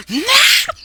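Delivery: very high, loud cartoon yelp; sudden shriek. Golden Freddy gets grabbed, high loud yelp. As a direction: blurt one piercing cry in a put-on voice. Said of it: "You hear Golden, with the Golden Freddy go".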